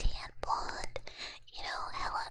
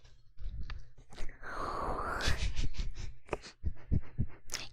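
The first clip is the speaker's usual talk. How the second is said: whispered laugh. Real, not acted.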